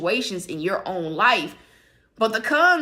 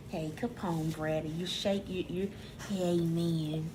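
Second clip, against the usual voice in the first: much softer, low coaxing voice to the dogs; soft talk to dogs; 4 semitones lower, 12 dB quieter than usual. Real, not acted. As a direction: murmur to the dogs at your feet. Talking softly to her dogs.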